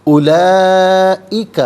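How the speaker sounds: اولئک with the long vowel drawn out slowly and loud; chanted اولئک, held long and high; elongated recitation. Shown as a demonstration. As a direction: stretch the madd in اولئک for five or six counts. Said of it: "ہر کلمے کو علیحدہ پھر ملا کر پڑھتے ہیں".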